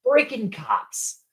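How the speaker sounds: high, slow, exasperated emphasis; mad rant. Real, not acted. Host ranting about the two film cops, mad and emphatic. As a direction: drag out each word in indignation. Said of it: "I'm mad about this one".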